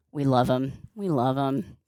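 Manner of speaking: soft, low and affectionate; fond murmur. Real, not acted. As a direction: drop low and quiet, full of affection. Fond aside after the cheering.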